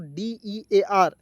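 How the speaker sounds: D E A R spelled very slowly, each letter set apart; teacher spelling it out for the class. Shown as a demonstration. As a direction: dictate each letter with long gaps. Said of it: "इसे पढ़ेंगे दोस्तों".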